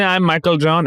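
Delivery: put-on high Indian-accented introduction. Fake officer introduces himself on video, imitated Indian accent. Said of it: "it's a dude with a Indian accent".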